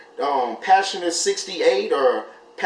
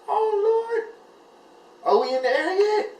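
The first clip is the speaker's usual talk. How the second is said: much higher, fast, anxious squeak; squeaky nervous question. Retold or imitated. A first-time flyer during takeoff, high squeaky nervous question. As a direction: squeal it out, dying to be airborne.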